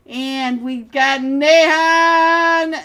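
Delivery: loud, high, slow announcement; excited call. Real, not acted. Caster hyping a player's arrival, loud slow excited call. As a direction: hype the name up.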